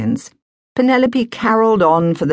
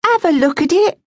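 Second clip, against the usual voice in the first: pleading housewife. Acted. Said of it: "begged Missus Tyson".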